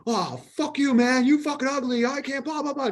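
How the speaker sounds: high-pitched mock rant, imitating an angry stranger; imitating furious woman. Woman in the car park cursing him out, high mock tirade. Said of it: "scorched earth".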